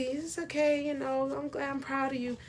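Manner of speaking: tiny, hushed mock encouragement; soft little put-on murmur; mousy voice. Shown as a demonstration. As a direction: go meek and barely audible, imitating a timid mother. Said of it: "not built to have no mousy ... voice".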